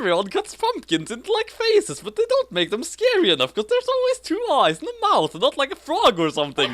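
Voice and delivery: lilting, mostly high mock-dramatic read; hammed-up reading. Reading the frog pumpkin line to her co-host, mock dramatic. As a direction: overplay every line of the bad story. Reads fanfic aloud dramatically.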